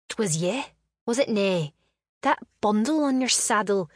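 put-on young Highland lass confronting her friend; Scottish lass. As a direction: play the heroine in a Scots lilt, pressing him hard. Voices heroine in Scots lilt.

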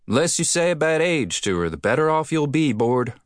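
a cheerful friend calling over. Acted. Sandy-haired man warns jokingly.

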